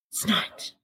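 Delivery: airy, unvoiced mock denial; pouting partner denies it in a breath; breathy whisper. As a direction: whisper it out in a sulk. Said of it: "why are you talking all breathy then?".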